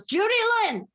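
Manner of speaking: high-pitched mock call for a child; mother calling out. Mother calls daughter in from playing, high calling-out voice.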